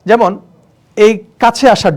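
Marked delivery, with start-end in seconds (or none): none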